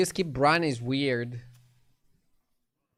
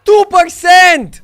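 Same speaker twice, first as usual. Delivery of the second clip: high, loud, slow drawn-out yell; outraged yell; 18 dB louder, much slower than usual. Real, not acted. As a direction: scream the number in disbelief. Losing at two percent odds.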